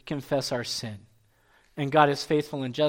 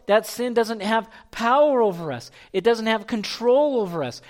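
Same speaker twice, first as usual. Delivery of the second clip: emphatic, raised preaching voice; full-force preaching; 5 semitones higher, 5 dB louder than usual. Real, not acted. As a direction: drive each clause home with force.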